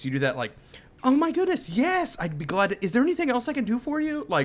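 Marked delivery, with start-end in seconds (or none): 1.0-4.2 high, gushing eager-to-please clerk; overly sweet retail voice; customer service voice. Retold or imitated. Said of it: "where you raise your voice".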